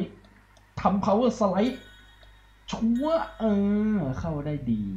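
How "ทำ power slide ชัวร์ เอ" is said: much higher, thrilled call of the slide; high excited gamer yelp; excited cheer. Real, not acted. Pulling off drift in race.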